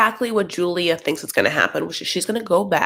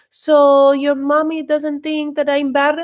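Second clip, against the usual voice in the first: higher, slow put-on Arabic accent; mock Arabic accent; 8 semitones higher than usual. Julia's inner voice teasing Brandon about his mommy, mock Arabic accent. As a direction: tease it out in a playful Arabic accent, pitched up. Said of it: "giving her Arabic accent".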